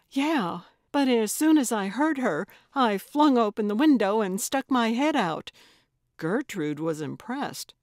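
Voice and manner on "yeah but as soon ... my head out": breathless, loud eager telling; excited. A narrator's reading. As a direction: rush it out, thrilled. Excited report of the ghost.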